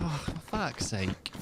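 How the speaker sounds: soft, slow weary sigh of words; quietly acted disappointment; deflated exasperation. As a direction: let down, cursing under the breath. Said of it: "you're just like".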